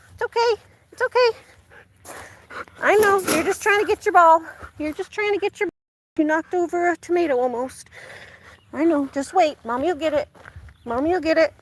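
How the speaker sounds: high soothing voice to the dog. Real, not acted. Owner comforting her dog, high soothing baby talk.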